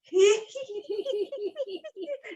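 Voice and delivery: put-on high hee-hee like a child; little kid's sneaky giggle; secretive kid giggle. Shown as a demonstration. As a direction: titter as if hiding a secret. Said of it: "you're like a little kid".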